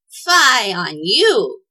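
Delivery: loud, drawn-out witchy rebuke; snapping witch. A narrator's reading. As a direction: snap at them like an angry witch, stretching it out. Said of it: "snapped the witch".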